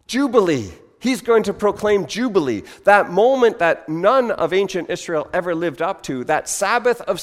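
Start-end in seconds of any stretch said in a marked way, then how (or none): 0.1-5.5 awed gasp into high exclamation; gasp, then proclaim it with delight; thrilled realization, pitched up; excited crowd. Acted. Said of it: "So they get very excited".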